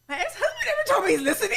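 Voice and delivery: high-pitched, laughing astonishment; hammed-up shock with the pitch jumping up; mock disbelief. Girlfriend amazed a man listens, high laughing disbelief. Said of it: "And I'm like".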